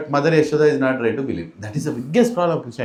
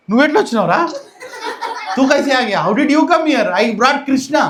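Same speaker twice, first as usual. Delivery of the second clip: high, loud disbelieving questions; flabbergasted; 8 semitones higher, 4 dB louder, much faster than usual. Retold or imitated. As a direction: demand an explanation in utter bafflement. Startled gopi finds her own son.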